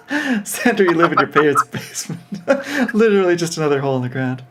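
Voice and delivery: amused aside, breaking up laughing. Real, not acted. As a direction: talk through real laughter. Narrator cracks up at own line.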